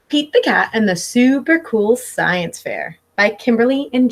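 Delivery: lively picture-book read-aloud; reading to children. Acted. Teacher reading Pete the Cat to her class, storybook voice. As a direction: read it like story time for little kids.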